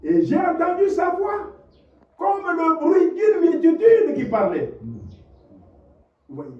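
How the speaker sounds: high, loud testimony with fervor; impassioned preaching. Acted. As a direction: proclaim it to the whole church. Testimony of hearing God's voice. (témoignage prêché avec ferveur, voix haute et forte)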